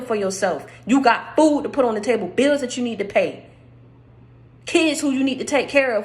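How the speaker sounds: preaching. Acted. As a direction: preach it hard to the congregation. Preaches at full force.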